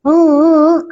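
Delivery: brief imitated sob, voice let out; voiced crying. Shown as a demonstration. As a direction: demonstrate crying out loud in prayer. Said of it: "jangan sampai keluar suara".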